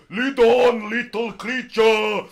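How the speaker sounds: loud, high, very slow giant voice; grand giant. Acted. Giant telling the pixie to lead, loud and slow. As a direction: command it grandly, drawn out. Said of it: "He's like".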